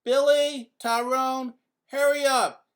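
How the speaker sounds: loud high call up the stairs. A narrator's reading. Mother calling her sons from downstairs, loud. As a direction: shout for the boys to hurry. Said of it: "Mom called out to them".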